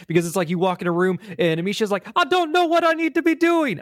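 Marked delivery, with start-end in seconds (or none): 2.1-3.8 very high-pitched mock girl's voice; badly faked accent, helpless and pitched way up; Amicia. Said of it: "I can't do her accent".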